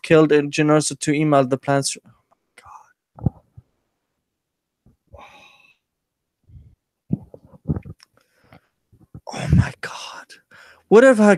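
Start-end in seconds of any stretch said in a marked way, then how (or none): 2.0-10.0 breathless stunned whisper; exasperated whispered oh my God. Real, not acted. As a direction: whisper it in disbelief. Stunned at the article's idea.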